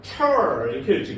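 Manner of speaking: mock English accent. Shown as a demonstration. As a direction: stretch 철 like an English word. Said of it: "영어야?".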